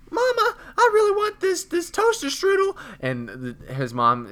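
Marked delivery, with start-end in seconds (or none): 0.1-2.7 put-on very high, slow whiny kid voice; Jimmy. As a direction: beg Mom for a treat in a loud, drawn-out whine. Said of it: "Jimmy does sound a little bitchy".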